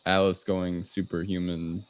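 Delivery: ordinary talk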